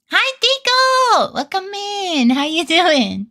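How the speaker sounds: very high, slow singsong welcome; high sweet shopkeeper welcome; cheery shop greeting. Pretend.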